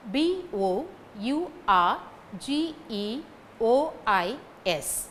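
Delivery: slow, letter-by-letter spelling. Shown as a demonstration. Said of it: "I spell out the word".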